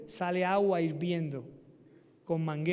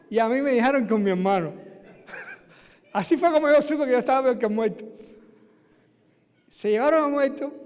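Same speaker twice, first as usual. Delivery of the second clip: laughing; 4 semitones higher, 7 dB louder than usual. Real, not acted. Laughs at his own story.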